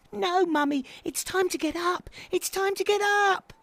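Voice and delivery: put-on high, pestering child voice; squeaky little owl, insisting; insistent baby owl. A narrator's reading. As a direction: pipe up high, nagging Mummy. Baby Owl insists to sleeping mother.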